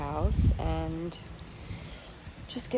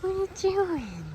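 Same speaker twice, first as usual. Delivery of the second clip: high pet-talk voice; 11 semitones higher, 4 dB quieter than usual. Real, not acted. Talking to the dog.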